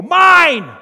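put-on a toddler screaming "Mine!" high and loud; toddler's shout. Toddler snatching a toy in church, shouting. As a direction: grab the toy with a shrill yell of ownership. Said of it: "and go".

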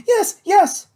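high, loud, eager insistence; excited insistence. A narrator's reading. Kate assures a doubtful friend she's sure, high and excited.

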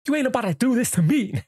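high-pitched mock protest; acted-out confrontation. Fed-up man standing up to the Joker, high-pitched mock protest.

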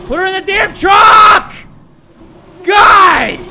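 screamed order at the screen; frantic yell. Real, not acted. Gamer yelling at the screen in panic.

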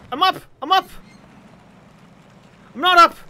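loud high excited yell; excited shout. Real, not acted. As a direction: shout with sudden joy. Triumph then failure.

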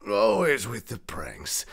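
ordinary talk

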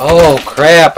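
loud, high startled outburst; alarmed shout. Real, not acted. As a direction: blurt it out as you get hit. Getting shot.

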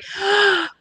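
high gasp of wonder; amazed gasp. Pretend. Impressed by the children's roar, amazed gasp.

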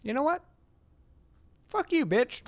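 loud and high, fake angry; mock angry outburst. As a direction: snap at the screen in jest. Mock yell at game character.

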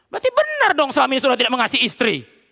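loud, high pulpit retort; full-force preaching. Real, not acted. Pastor rebuking husband who resents sermon on loving wives, forceful preaching.

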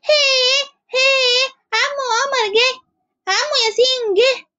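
put-on high, drawn-out children's cry of joy; children calling out. A narrator's reading. Children announce uncles Omar and Yasin arriving, high excited calls. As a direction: kids spotting their uncles and calling out slowly. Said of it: "الأطفال بصريخ".

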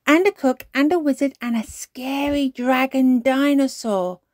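put-on high, loud, excited child storyteller; cheering. A narrator's reading. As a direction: pile on story characters with glee. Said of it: "cheered Peppa".

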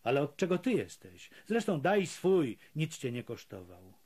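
usual speaking voice

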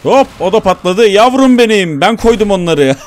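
high and loud triumphant gloating; gleeful shouting. Real, not acted. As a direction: crow over the kills. Gleeful over explosions.

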